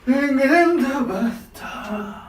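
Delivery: high, loud, slow and strained; strained from cold. Real, not acted. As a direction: push the words out through the cold shock. Sitting down in ice bath.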